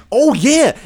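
ordinary delivery

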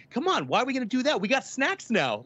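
put-on gleeful kid who just got snacks; mock excited friend in the back seat. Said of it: "he's all excited 'cause they have food".

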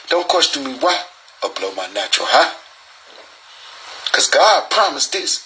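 ordinary delivery

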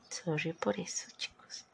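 soft whispered sorry to listeners; whispered apology. Real, not acted. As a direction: drop to a sheepish whisper. Apologizes for fumbling the list.

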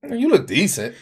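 offhand compliment with a lift on "decent"; his own delivery. Shown as a demonstration. Man showing how he answers someone asking about looks, understated. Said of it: "See, that's how he said".

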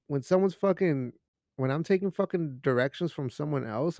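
ordinary talk

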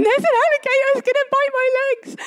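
high, hammed-up panic; panicking. Mum imagining alligators on the sinking boat, mock panic, pitched high. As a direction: squeal it like a mock-terrified tourist. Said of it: "like, panicking".

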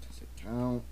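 whispered question; whispering. Real, not acted. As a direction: breathe it out as a whisper. Whispered aside.

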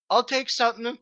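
high, small interjection from a tied-up character; lightly voiced captive. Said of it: "Scotty just still tied up going".

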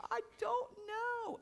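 put-on high, slow whine of a sulky boy; whiny kid voice. Son answering his father, imitated in a high whine. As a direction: whine it like a caught child. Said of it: "And he's just like".

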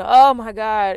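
loud anguished outcry, acted; distraught cry, imitated. Someone too distraught to pray properly, imitated anguished cry. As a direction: cry out as if words have run out. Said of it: "When we are too distraught".

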